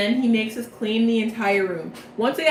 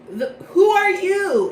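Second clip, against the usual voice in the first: haughty challenge; 9 semitones higher, 6 dB louder than usual. Retold or imitated. Vil confronts Deuce.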